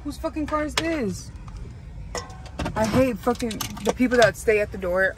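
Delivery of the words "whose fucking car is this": ordinary talk, nothing marked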